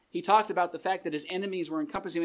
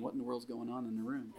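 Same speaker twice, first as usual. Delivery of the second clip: complaining front desk; 5 semitones lower, 8 dB quieter, faster than usual. Pretend. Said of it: "Front desk is gonna come over here complaining".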